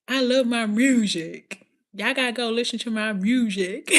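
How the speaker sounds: imitated Memphis accent, leaning on "music"; playful take on a Memphis rapper's accent. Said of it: "She be like".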